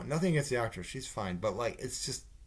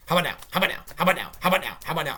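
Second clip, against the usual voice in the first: loud, higher, rapid-fire mock pestering; insistent mock badgering; pestering interruptions; 5 semitones higher, 7 dB louder, much faster than usual. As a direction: imitate a nagging child who keeps interrupting. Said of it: "she just keeps interrupting the mayor".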